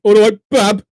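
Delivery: mock Birmingham accent. Girl answering a chat-up, in broad Birmingham accent. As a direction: blare it in a put-on Birmingham accent. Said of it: "As soon as that accent came out".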